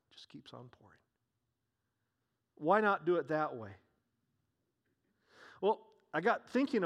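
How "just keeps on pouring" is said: whispered in hushed wonder; a soft awed whisper about the endless jar. Shown as a demonstration. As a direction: breathe it out as a whisper. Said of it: "It just keeps on pouring".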